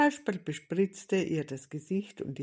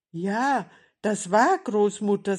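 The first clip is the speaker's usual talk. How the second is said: urgent and louder, pitched up; alarmed; 4 semitones higher, 8 dB louder than usual. A narrator's reading. Kasperl recognising Grandmother's cry for help, alarmed. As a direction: confirm it with alarm.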